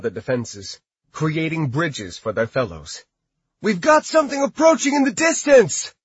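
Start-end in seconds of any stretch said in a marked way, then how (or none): 3.6-5.9 high, loud scout's call. Acted. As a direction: call it out from the wall. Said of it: "a scout called out".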